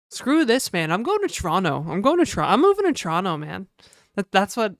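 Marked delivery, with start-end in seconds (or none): 0.1-3.7 lively, swooping New Brunswick talk, "Toronto" as "Tronno"; hammed-up New Brunswick local; New Brunswick accent. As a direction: act out a fed-up New Brunswicker. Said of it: "that's what it's like in New Brunswick".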